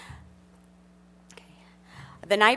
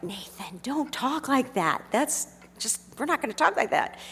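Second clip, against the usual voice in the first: mild scolding; 5 semitones higher than usual. Retold or imitated. Father scolding son, retold.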